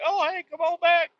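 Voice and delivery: squeaky hostess welcome; high falsetto waitress. Retold or imitated. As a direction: pitch way up to play the friendly waitress. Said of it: "they're like".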